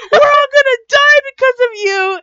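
high, loud mock-panicked shriek; hammed-up screaming blame; Louise. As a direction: imitate a hysterical accusing shout. Acting out Louise's panicked outburst.

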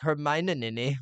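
Hermione garbled as her-mine-en-ninny; botched Hermione, rising at the end; mangled name. Retold or imitated. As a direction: stumble through Hermione with extra syllables. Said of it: "Every time he'd be like".

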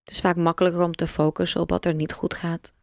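ordinary delivery

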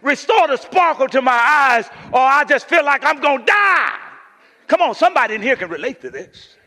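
loud, high, urgent preacher cry; full-force preaching. Acted. Preacher pleading for sparkle in his eyes, full-force preaching. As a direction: preach it out to the room.

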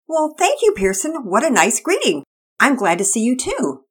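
high, friendly neighbor lady; a pleased neighbor returning a greeting. Acted. Missus Hicks voiced.

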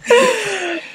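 high-pitched burst of laughter. Real, not acted. Laughing after impression joke.

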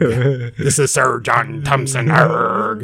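low growling pirate; gruff pirate host. Acted. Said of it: "Now he sounds like a pirate".

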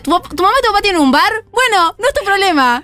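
high-pitched playful telling-off; mock scolding. Columnist teases parents about baby's late nights, mock scolding.

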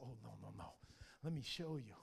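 very soft, gentle denial; hushed correction. Retold or imitated. God answering a doubting young man, very softly. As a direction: wave it away quietly. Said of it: "God just whispered".

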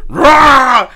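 loud mock monster roar; zombie roar. Imitating zombie Shane's jump scare, loud mock roar. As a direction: snarl like a lunging zombie.

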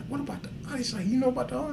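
high, soft hushed question; a girlfriend's hushed question, high and soft. Retold or imitated. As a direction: lean in and ask it under your breath.

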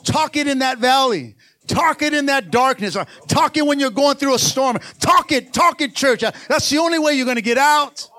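high, loud shouted preaching; preaching at full force. Acted. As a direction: rouse the congregation from the pulpit.

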